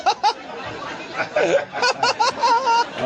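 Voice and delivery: long high cackle at his own joke; high cackling laugh. Real, not acted. Comic cackles at his own dark joke, high cackle.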